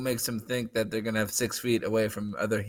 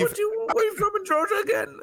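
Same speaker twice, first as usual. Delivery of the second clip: much higher, hammed-up Georgia voice; Southern accent. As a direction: squeal it like an over-the-top Georgia local. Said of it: "He sound like a Georgia boy to me".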